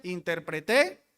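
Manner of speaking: interpreté with the stress on the final TÉ; interpreTÉ, final syllable stressed; stress on last syllable. Shown as a demonstration. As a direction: push the end of interpreté. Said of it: "Son tres cosas distintas".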